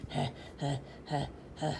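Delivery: breathless huffing puffs; mock out-of-breath huffing; panting rhino. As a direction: imitate a tired rhino panting. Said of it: "rhinoceros at the end who's like".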